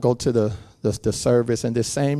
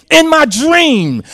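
normal talking voice

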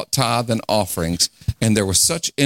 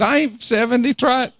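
much higher, sassy church lady comeback; 13 semitones higher than usual. Retold or imitated. Preacher voicing a woman's sassy reply, high mock voice. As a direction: shoot it back high like an indignant auntie. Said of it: "She said".

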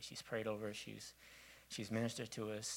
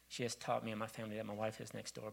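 usual speaking voice

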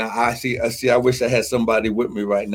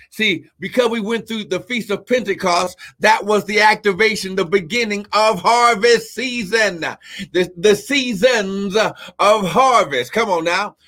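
preaching at full force; 8 semitones higher than usual. Acted. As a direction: climb into the preaching peak. Preaching climax continues.

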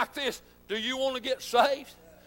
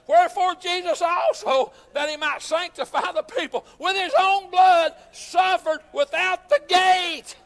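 higher, louder, slowing on the last words; fervent scripture reading; 5 semitones higher, 6 dB louder than usual. Acted. Preacher reading Hebrews aloud to the church, proclaiming. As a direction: proclaim the verse from the pulpit. Scripture read at full force.

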